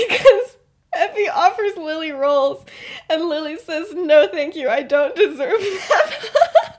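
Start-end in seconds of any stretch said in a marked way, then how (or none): 3.9-6.7 laughing through mock-humble words; slow, loud, cracking up mid-quote; laughing retelling. Teller laughing while quoting Lily's fake guilt. Lily overplays guilt at dinner.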